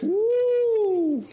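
high, loud happy whoop; excited whoop. Real, not acted. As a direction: cheer for the milestone. Cheering the third season.

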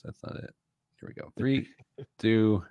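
usual speaking voice

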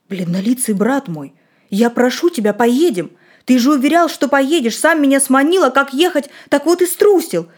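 beg, then scold him for backing out; pleading, then angry. Acted. Boy pleading then scolding friend.

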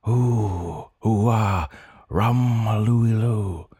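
high humming creature moan. A narrator's reading. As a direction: croon it like a longing beast. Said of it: "I moan".

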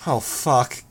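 ordinary delivery, nothing marked

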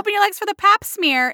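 high mock doctor talking to a child; hammed-up kiddie-doctor voice; chirpy doctor. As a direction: pitch it way up, sing-song doctor patter.

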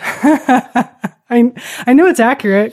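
laughing through the words; giggling while talking fast. Real, not acted. Laughing at her own joke.

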